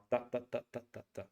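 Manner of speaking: "da, da, da" in equal beats, no wave; even syllable beats. Shown as a demonstration. Teacher showing Spanish and Russian rhythm, equal beats on "da". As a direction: tap out every "da" with identical weight. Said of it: "in Spanish and Russian".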